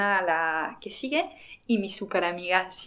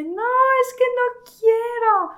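high, slow tearful whimper in mock; hammed-up weepy protest; imitating crying friend; 7 dB louder than usual. Retelling her crying friend refusing the crown, high tearful whimper. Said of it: "Está llorando".

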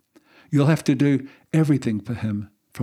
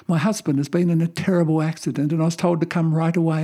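higher, urgent, rushed pleading; frantic; 6 semitones higher, 4 dB louder than usual. Acted. Wife rushing into the hospital to the receptionist, frantic and high. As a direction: burst in desperate to find him. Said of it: "frantically said".

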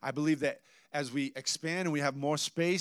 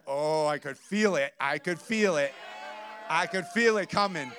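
louder, rising, rehearsing anger coming on; building agitation; 2 semitones higher, 4 dB louder than usual. Retold or imitated. Pastor retelling his rising anger at the store, building agitation. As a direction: feel it rising inside, half-joking. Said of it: "'Cause I was like".

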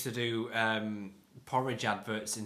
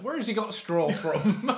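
higher laughing West Country twang; West Country accent; 8 semitones higher, 3 dB louder than usual. Retold or imitated. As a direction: mimic a rustic West Country local. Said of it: "Tom just went".

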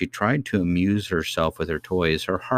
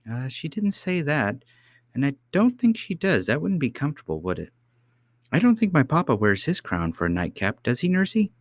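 high, swooping little princess; child princess, earnest; 9 semitones higher than usual. A narrator's reading. Princess asking nurse about papa's crown, innocently earnest.

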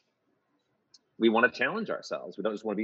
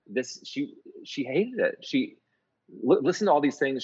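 mildly upset, a bit higher; retold upset phone rant; 5 semitones higher, 3 dB louder than usual. Composer phoning partner about a bad review, mildly upset. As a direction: replay your upset complaint, lightly heated. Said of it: "I was so upset".

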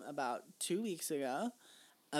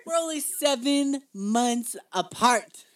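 loud, high, slowly drawn-out echo; mock-dramatic emphasis; 8 semitones higher, 12 dB louder, slower than usual. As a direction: hammer out every word for drama. Echoes the fact for dramatic emphasis.

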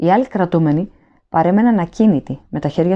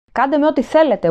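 a high, raised howl of terror; howling plea; 9 semitones higher, 3 dB louder than usual. A narrator's reading. Prisoner howls at the officer, terrified scream. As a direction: scream it out in panic. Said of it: "ούρλιαξε".

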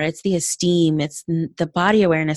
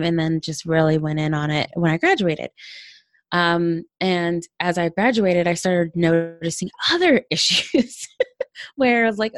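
normal talking voice

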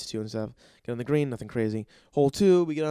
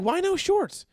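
much higher, louder squeaky question; mock incredulous; 5 dB louder, slower than usual. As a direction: squeak it in disbelief. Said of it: "It's like".

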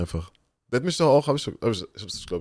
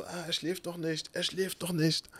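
high, soft, eerie doctor voice; doctor imitation; 8 semitones higher, 9 dB quieter than usual. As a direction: imitate a doctor heard hazily from the operating table. Voices doctor over half-anaesthetised boy. (hohe, leise Arztstimme, nachgemacht)

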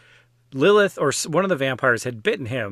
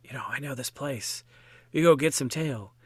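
much softer, conspiratorial come-on; sly hushed tout; 2 semitones lower, 8 dB quieter than usual. Retold or imitated. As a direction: lure them in on the quiet like a shady tout. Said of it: "there would be a guy who's like".

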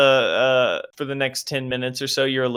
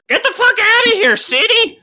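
a bar patron spits in the drink and runs off the city folk, shrieking; angry patron yelling; 11 semitones higher, 6 dB louder than usual. Acted. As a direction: scream him out of the bar, voice cracking high.

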